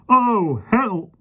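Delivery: high, loud panicky cries; gasping for help. A narrator's reading. Policeman gasps for help as the lion hugs him, high panic. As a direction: gasp out for help. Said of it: "the policeman gasped".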